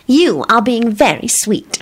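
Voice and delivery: high, slow, sweet praise; approving. A narrator's reading. Solange delighted with Quentin's request, approving. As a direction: draw it out approvingly. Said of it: "she told him approvingly".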